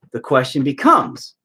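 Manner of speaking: ordinary talk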